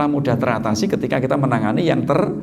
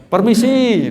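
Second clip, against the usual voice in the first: polite call at door; 7 semitones higher, 4 dB louder, slower than usual. Pretend. Debt collector knocking at door.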